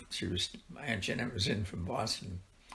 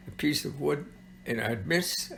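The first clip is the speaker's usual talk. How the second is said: normal talking voice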